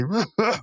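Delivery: high mock hee-haw laugh; imitated guffaw. Muscle Man heard outside, imitated guffawing. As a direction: guffaw like an oaf, put on. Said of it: "hee-hawing and guffawing outside".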